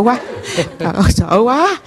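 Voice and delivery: retold scare breaking into laughter; laughing mock fright. Woman recalls hearing ghostly footsteps, laughing at her fright. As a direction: laugh through the cry of fear.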